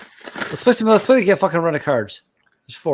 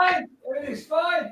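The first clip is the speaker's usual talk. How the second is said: calling out. Real, not acted. Calling out from another room.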